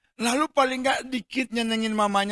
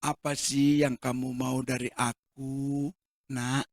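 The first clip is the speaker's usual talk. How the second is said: gentle question; 6 semitones lower than usual. Acted.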